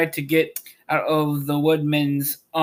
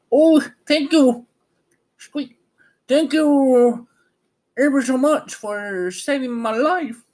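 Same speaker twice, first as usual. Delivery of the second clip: much higher, tiny squeaky mouse voice; squeaky little voice; 11 semitones higher, 3 dB louder than usual. A narrator's reading. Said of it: "it said in a squeaky little voice".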